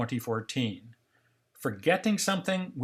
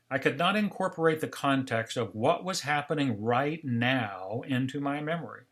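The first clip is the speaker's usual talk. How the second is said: usual speaking voice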